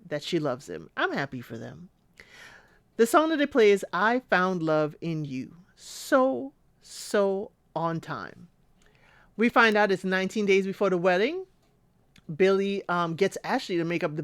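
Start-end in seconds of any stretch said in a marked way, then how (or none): 5.8-8.5 slow whispered aside; slow hushed whisper. Real, not acted. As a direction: breathe it out slowly as an aside.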